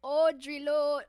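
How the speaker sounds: a quick hammed-up British accent; mock British accent. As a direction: say "Audre Lorde" in a playful fake British accent. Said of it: "this little British accent thing".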